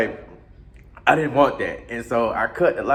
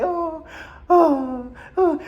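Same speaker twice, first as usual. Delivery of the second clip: mock moaning; 14 semitones higher, much slower than usual. Woman moaning again.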